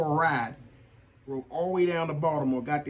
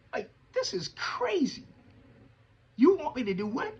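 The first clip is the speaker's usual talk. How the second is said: incredulous; 8 semitones higher than usual. Retold or imitated. Reacting to being asked.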